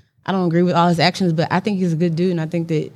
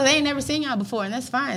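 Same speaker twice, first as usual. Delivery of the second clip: ordinary talk, nothing marked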